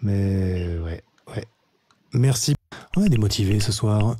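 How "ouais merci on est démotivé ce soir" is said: ordinary talk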